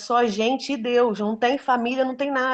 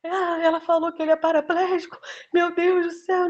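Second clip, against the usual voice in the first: higher, wailing mock lament; distraught sister-in-law; 7 semitones higher than usual. Imitating the sister-in-law's distressed cry at the hospital, higher. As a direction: play the panicked relative, voice pitched up.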